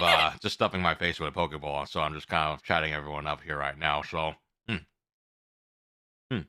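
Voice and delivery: talking with a mouthful; chewing while talking; mouth full. Real, not acted. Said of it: "talking with my mouth full".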